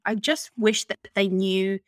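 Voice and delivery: ordinary talk, nothing marked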